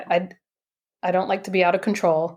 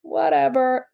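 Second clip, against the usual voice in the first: dismissive; 6 semitones higher than usual. Retold or imitated. Sceptical reply to healer's prophecy.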